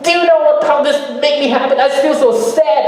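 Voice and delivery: acted venting to a partner, high and upset. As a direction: pour out hurt feelings in a high, overwrought voice. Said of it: "we vent it all out".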